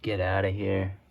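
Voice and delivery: normal talking voice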